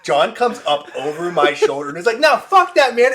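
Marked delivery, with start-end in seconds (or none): 2.2-3.1 very high, loud defiant yell; full-volume retold outburst; re-enacted belligerent shout. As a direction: shout it like a hothead charging into a fight. Acting out John lunging into fight.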